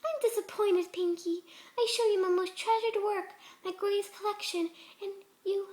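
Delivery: hurt and cold, trailing off; disappointed Fluttershy. A narrator's reading. Fluttershy reproaches Pinky.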